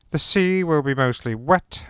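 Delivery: normal talking voice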